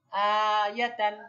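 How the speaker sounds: আয়াতান stretched slowly, each syllable held, loud and clear; teacher drawing out আয়াতান for students to copy; slow model word. Shown as a demonstration. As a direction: spell আয়াতান out slowly for the class. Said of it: "আমরা একটু practice করি".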